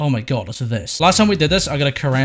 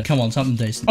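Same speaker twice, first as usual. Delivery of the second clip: ordinary talk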